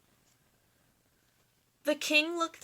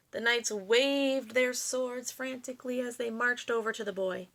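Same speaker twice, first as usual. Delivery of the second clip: ordinary delivery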